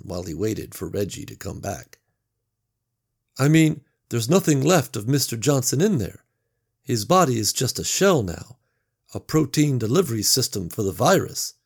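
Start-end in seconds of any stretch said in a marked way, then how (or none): 3.4-11.5 louder, forced reasoning; self-convincing Gary. Acted. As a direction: talk yourself into it.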